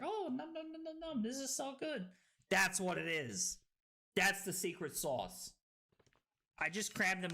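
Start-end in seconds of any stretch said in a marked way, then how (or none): 0.0-2.1 high, soft playful gobbling voice; munch happily in a small high voice; mock delighted munching. Acting out tasting flavored water, mock delighted munching. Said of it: "and then it's like".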